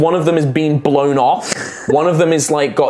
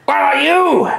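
higher, condescending goblin voice, touches of threat; smart goblin; 5 semitones higher than usual. Acted. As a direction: sound like a clever goblin tired of fools.

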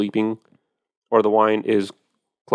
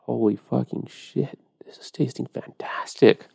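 slow, hushed whisper of amazement; whispered astonishment; awed whisper; 2 semitones higher, 6 dB quieter, slower than usual. Retold or imitated. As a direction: breathe it out in disbelief. Awed reaction to tasting wine.

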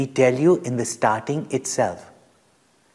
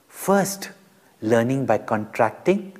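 ordinary talk, nothing marked

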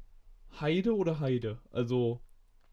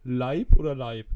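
loud quiz call; 11 dB louder than usual. Shown as a demonstration. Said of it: "Mit A oder E".

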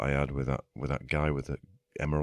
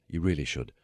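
usual speaking voice

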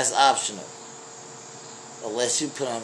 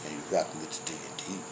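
low, silky radio crooner; deep bass DJ voice; smooth deep voice; 9 semitones lower, 10 dB quieter than usual. Acted. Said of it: "This is Barry, Barry Smooth".

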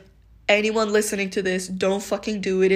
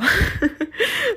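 a sudden high burst of laughter; bright laugh; 13 semitones higher, 3 dB louder than usual. Real, not acted. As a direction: laugh at yourself, high and bubbly. Laughs at her own intro.

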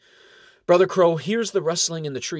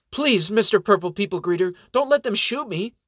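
high, pleading cry for mercy; begging; 8 semitones higher, 4 dB louder than usual. Acted. Elf hiding behind the monk begging the archers, pleading. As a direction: plead frantically, voice climbing high. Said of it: "and begs".

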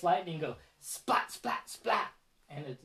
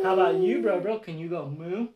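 ordinary delivery, nothing marked

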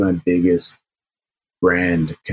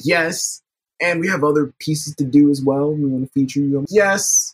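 excited; 9 semitones higher than usual. Retold or imitated. Eager retold reply.

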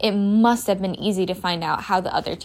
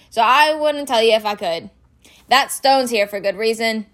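louder, slightly higher, reluctant big-man dialogue; gruff groundskeeper Hagrid, grudging; 3 semitones higher, 5 dB louder than usual. Acted. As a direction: refuse them grudgingly, in a loud character voice. Voices Hagrid in story.

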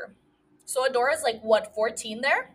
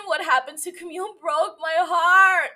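emotional; 6 semitones higher, 5 dB louder than usual. Real, not acted. Podcaster grieving for a book character, emotional. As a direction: wail it out, heartbroken. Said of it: "Why am I so emotional?".

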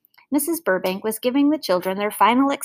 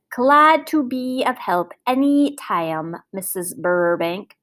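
very slow, over-enunciated, self-important speech; speech teacher showing off painfully distinct diction; over-correct speaker; slower than usual. Acted. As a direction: draw out every word with pompous precision. Same neighbour ending the phone call.

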